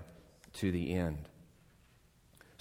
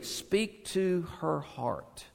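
slow, softer, tender delivery; speaking tenderly; 4 semitones higher, 7 dB quieter, slower than usual. Acted. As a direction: let it fall gently on the heart. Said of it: "Speak tenderly to Jerusalem".

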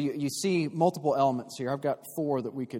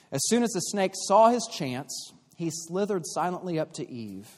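lively read-aloud storytelling voice; storybook reading; 3 semitones higher than usual. Acted. Preacher reading a children's Bible to the congregation, lively storytelling.

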